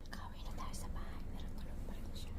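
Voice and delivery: hushed whisper, don't wake him. Real, not acted. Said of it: "nakatulog na siya".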